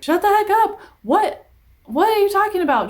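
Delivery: high, loud mocking of annoyed parents; exasperated parents. Parents telling their breathless child to stop, loud exasperated mock yell. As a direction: snap at the kid like a fed-up parent.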